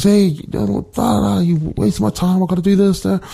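sketch a dad's scolding half-heartedly; mock scolding. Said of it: "he just scolds her, tells her off".

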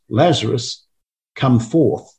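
ordinary talk, nothing marked

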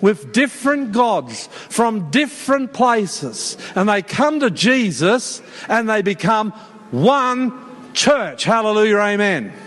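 high, deliberate preaching cadence; emphatic preaching. Real, not acted. Preacher rallying the church round one gospel, emphatic preaching. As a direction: drive home each phrase with weighty pauses.

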